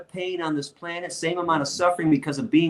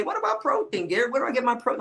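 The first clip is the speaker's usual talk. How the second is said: mocking dumb questions; 9 semitones higher, 3 dB quieter, faster than usual. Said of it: "Like the, the dumbest shit".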